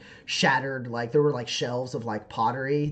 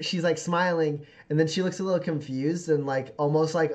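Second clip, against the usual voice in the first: ordinary talk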